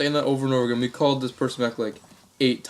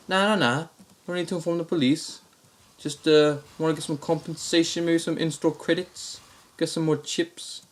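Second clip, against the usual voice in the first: higher-pitched put-on Aussie voice; Australian complainer, pitched up; Australian accent; 5 semitones higher than usual. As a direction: haggle as an Australian prank caller. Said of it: "the same Australian accent".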